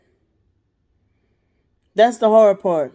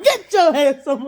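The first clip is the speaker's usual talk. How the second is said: mock drill yell; 5 semitones higher than usual. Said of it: "I hear somebody".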